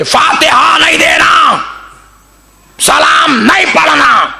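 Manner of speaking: slow, high, furious pulpit shout; angry shout. Retold or imitated. Imitating an angry maulvi banning prayers at Friday sermon, shouting. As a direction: thunder the ban from the pulpit, word by word. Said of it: "गुस्से में आके बोल दिए".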